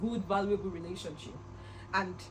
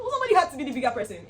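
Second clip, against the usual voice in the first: quick, louder throwaway line; rushed quip; 4 semitones higher, 8 dB louder, much faster than usual. Retold or imitated. Vlogger joking to friend, rushed and louder. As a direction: rattle it off fast.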